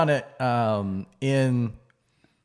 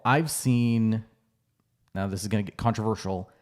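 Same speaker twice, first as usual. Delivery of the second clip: ordinary talk, nothing marked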